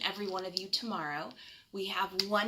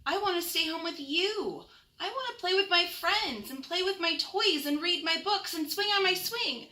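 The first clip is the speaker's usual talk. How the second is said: put-on high, louder child's voice, begging; a small boy pleading to stay home; pleading little raccoon; 10 semitones higher, 4 dB louder than usual. Chester pleads with mother.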